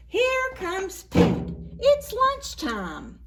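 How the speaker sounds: high, slow and loud excited announcement; excited announcer. Acted. Teacher introducing Pete to the children, high slow and loud. Storyteller voice for flannel board.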